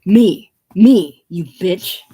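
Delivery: very loud, forceful first words; furious hiss. Acted. Chance seizing princess by the hair, furious hiss. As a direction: demand attention with angry force. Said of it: "seizing her by the hair and hissing".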